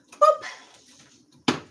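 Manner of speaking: high squeaky boop; playful high boop as the can goes down; mock sound effect. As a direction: pop it out like a cartoon noise.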